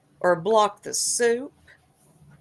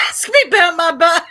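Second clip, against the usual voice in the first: laughing shout; 9 semitones higher, 10 dB louder than usual. Real, not acted. Laughing at donor name.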